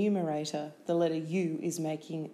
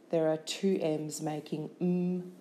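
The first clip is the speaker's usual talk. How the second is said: normal talking voice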